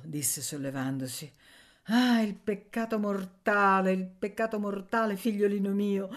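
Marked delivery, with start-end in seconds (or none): 1.8-6.1 lament. Acted. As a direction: sigh over the sinner. Zia Tatana laments. (lamento di zia, più alto e lento)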